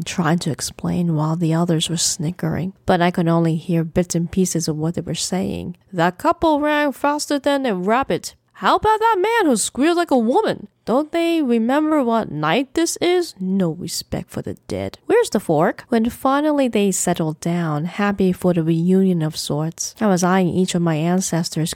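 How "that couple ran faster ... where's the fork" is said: put-on high, gleeful old ghost voice; much higher, amused ghostly chatter; cackling ghost ancestor. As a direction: gloat like a mischievous spirit. Ancestors gleefully recount scaring people.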